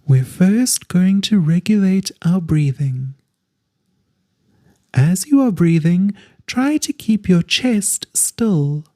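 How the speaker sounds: calm guided relaxation voice drawing out each instruction; guided meditation. Acted.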